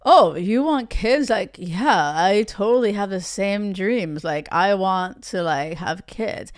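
low mock player, agreeing too easily. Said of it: "play this out from the player's perspective".

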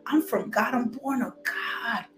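ordinary talk, nothing marked

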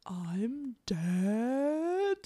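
drawn-out mock deadpan with a slow rising glide; mock-dramatic, long-held last word; Adam West. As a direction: stretch both words out, hammed-up impression. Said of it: "That's my best Adam West impression".

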